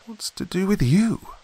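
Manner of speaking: high exasperated outburst; bursting out. A narrator's reading. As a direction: demand it, patience gone. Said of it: "I burst out".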